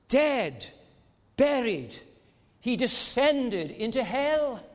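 high, loud pulpit proclamation; a preacher in full cry; full-force preaching. Acted. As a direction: proclaim it to the back row.

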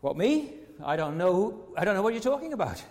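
imitating a startled denial, pitch jumping up; feigned innocence. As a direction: play Peter caught off guard, protesting. Acting Peter's first denial to girl.

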